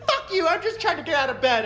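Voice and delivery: high, laughing put-upon whine; mocking everyone. Voicing quarantine meme replies, laughing put-upon whine. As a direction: play the exhausted crowd snapping back. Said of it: "everyone was like".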